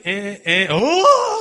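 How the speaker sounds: huge, high, loud "oh!"; mock amazement. Acting out being won over by Steins Gate, amazed. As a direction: shoot up into an exaggerated cry of awe. Acts indifference turning to amazement.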